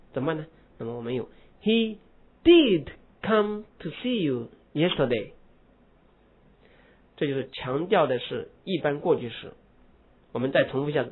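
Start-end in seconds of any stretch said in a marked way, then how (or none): 1.6-5.3 slow did come, did lifted; stress on did. Shown as a demonstration. Teacher demonstrating emphatic did sentence. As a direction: press did to confirm. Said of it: "他昨天确实来看过你了".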